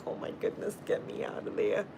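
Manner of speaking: whispered, as if wilting in the heat; whispered aside. Retold or imitated. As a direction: breathe it out like you're overheated. Retelling Miami heat, quoted self.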